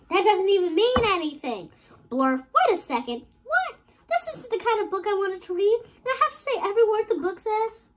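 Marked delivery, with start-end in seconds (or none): 3.4-7.9 high, startled protest; surprised. Acted. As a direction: act caught off guard. Reader protests book's words.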